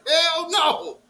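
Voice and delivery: high, loud emphatic refusal; hell no. Real, not acted. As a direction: snap it out.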